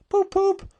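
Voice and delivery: high, loud honking poop poop; car horn poop poop. A narrator's reading. Toad imitates a motor horn, very high and loud.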